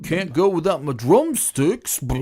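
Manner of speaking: loud, slow, hammed-up movie quote; movie character impression. Co-host joining in on the Superman four quote, hammed-up impression. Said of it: "Oh, Superman four".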